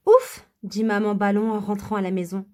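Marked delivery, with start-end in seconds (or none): 0.1-0.5 grand ouf aigu et fort; ouf soulagé. Acted. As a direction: souffle de soulagement bruyant. Soulagement après le sauvetage. (ouf de soulagement, aigu et fort)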